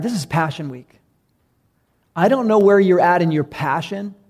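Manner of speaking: ordinary talk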